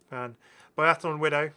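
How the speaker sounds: ordinary talk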